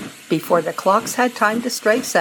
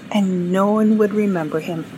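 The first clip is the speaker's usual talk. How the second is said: ordinary delivery, nothing marked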